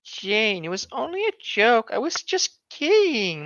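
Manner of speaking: sugary mock apology; sweet fake apology. Marcy's sweet false apology.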